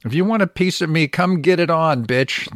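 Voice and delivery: challenge to a fight. Retold or imitated. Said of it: "the boy says".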